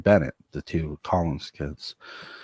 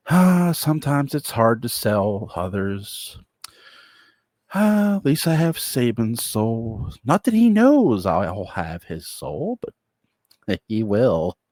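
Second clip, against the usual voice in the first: a higher, pleased villain's aside; gloating devil; 5 semitones higher, 4 dB louder, slower than usual. Acted. Devil gloating about a soul, lightly higher and slow. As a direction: savor the win out loud.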